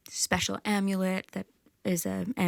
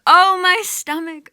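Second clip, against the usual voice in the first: wailing cry; 12 semitones higher, 12 dB louder than usual. Acted. Goddess in the underworld wailing for her husband, loud keening wail. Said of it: "she's crying out".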